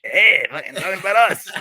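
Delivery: put-on offended Boston moviegoer, Boston accent; imitating Boston locals. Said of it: "people must have been like".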